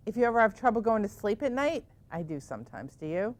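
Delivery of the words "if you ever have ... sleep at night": usual speaking voice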